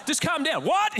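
high, incredulous outburst; hammed-up indignant disbelief; mock exasperation. Mocks the advice, exasperated.

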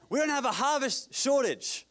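loud, high-pitched declaration; preaching shout. Real, not acted. Preacher drives the point home to his church, preaching shout. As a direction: proclaim it at full force. Shouted repeat of the measured line.

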